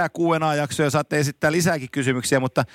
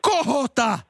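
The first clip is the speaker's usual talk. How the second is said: higher, drawn-out fan yell; mock shout; 8 semitones higher, much slower than usual. Imitating the old fan yelling at the goalkeeper, mock shout. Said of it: "se huusi".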